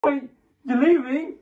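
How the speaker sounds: high, loud, alarmed question; panicked disbelief. Acted. Caller learns the helper is leaving, panicked disbelief. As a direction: cry out as your helper abandons you.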